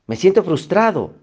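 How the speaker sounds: más agudo y más fuerte, soltando la frustración; "Me siento frustrado" exclamado alto y fuerte, con ímpetu; frustración exclamada. Shown as a demonstration. Ejemplo de identificar la emoción. (descubriendo su frustración con ímpetu)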